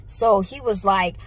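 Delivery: ordinary delivery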